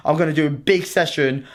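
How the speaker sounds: usual speaking voice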